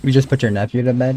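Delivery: ordinary talk, nothing marked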